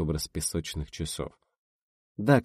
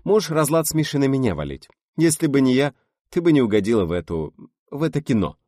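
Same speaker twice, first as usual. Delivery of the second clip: curt; 8 semitones higher, 7 dB louder than usual. A narrator's reading. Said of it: "сказала отрывисто".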